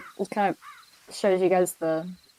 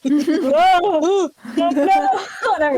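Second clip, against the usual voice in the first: laughing; 7 semitones higher, 7 dB louder, much slower than usual. Real, not acted. Laughing, dodging the question.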